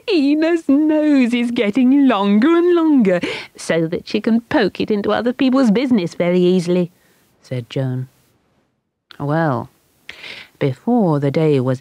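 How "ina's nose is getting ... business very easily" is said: put-on high, loud, teasing child voice, fast at the end; mocking child. A narrator's reading. Children jeering at Ina, high, loud and teasing. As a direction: jeer at Ina's nose. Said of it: "shouted with laughter".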